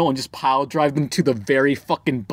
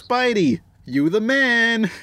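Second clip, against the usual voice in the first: higher, drawn-out hero praise; cartoon fan; 8 semitones higher, slower than usual. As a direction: cheer him on, stretching the last words. Family Guy bit acted out.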